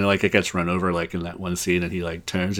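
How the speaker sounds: ordinary talk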